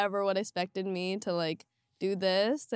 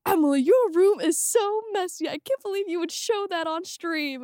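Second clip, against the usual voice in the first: mom voice; 8 semitones higher, 6 dB louder than usual. Retold or imitated. Mom voiced texting complaint.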